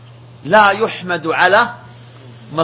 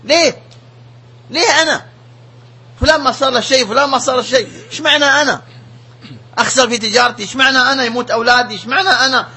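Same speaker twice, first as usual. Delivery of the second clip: higher, pleading imitation of a protester; complaining to God; 5 semitones higher, faster than usual. Man questioning God over his losses, higher pleading complaint. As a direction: mimic a man crying why me at heaven. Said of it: "بل بعضهم يسائل الله".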